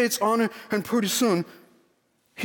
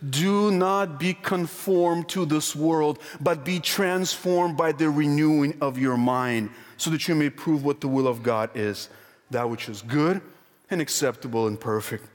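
solemn scripture reading; 5 semitones lower than usual. Acted. Reads Romans verse solemnly.

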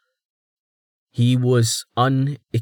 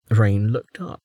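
normal talking voice

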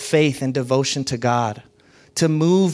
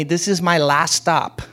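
quoted congregant; 5 semitones higher than usual. Retold or imitated. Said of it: "several people tell me".

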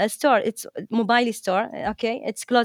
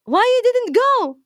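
higher, sharply scolding imitation of mother; mother's angry question; 11 semitones higher, 4 dB louder than usual. Mother scolding her daughter for skipping the interview, angrily imitated. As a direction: snap it at her like an upset parent. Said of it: "my mother, you know what, was angry".

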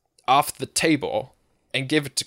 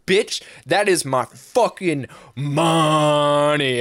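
slow, heavy, menacing, every word weighted; slow menacing threat; 4 dB louder, much slower than usual. Retold or imitated. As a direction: lay each word down like a warning. Stripper confronting the thief.

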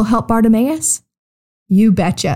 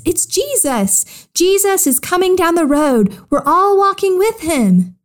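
high, excited call from the crowd; calling back; 8 semitones higher than usual. A narrator's reading. Someone in the crowd to a blind man, calling back. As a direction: call the news back in a raised pitch. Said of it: "Somebody called back to Bartimaeus".

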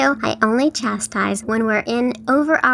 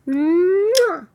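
blowing an air kiss; 7 semitones higher than usual. Real, not acted. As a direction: blow a big kiss at the listeners. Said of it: "Great big hugs and kisses".

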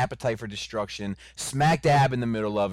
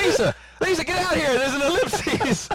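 very high, loud mock alarm call; imitating Greg; 4 dB louder than usual. Co-host mimicking someone calling Lisa to get out, high and hammed-up. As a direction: call out urgently at the top of your range. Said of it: "Greg was like".

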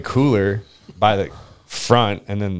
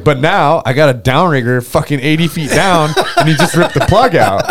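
mock exasperation; 6 semitones higher, 5 dB louder than usual. Retold exasperation at new problem.

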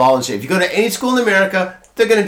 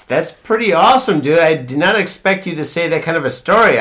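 ordinary talk

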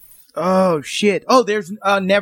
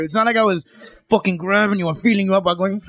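ordinary delivery

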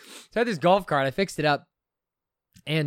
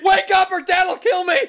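high, slow, loud panicked yell; mock kid panicking, yelling at his sister to wake up; panicked shout; 10 dB louder, slower than usual. Kid shouting at a knocked-out sister, panicked.

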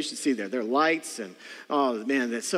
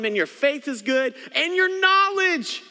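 much higher, slow gushing mock praise; sarcastic flattery; 10 semitones higher, 5 dB louder than usual. Paul praising the church's knowledge, high sarcastic gushing. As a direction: gush with exaggerated fake admiration. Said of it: "And then he goes on".

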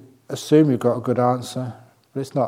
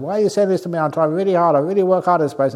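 higher, louder defensive protest; mock yelling back; 6 semitones higher, 5 dB louder, much faster than usual. Worker yelling back at whoever shouted, mock indignant. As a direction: argue back in a half-acted yell. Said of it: "start yelling back at them".

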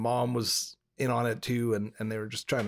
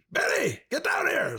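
calling upstairs; 13 semitones higher than usual. Retold or imitated. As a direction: holler up to the daughter. Dad calls daughter upstairs.

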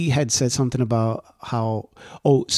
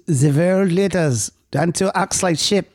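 mock German accent; 5 semitones higher, slower than usual. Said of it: "if you said that in a German accent".

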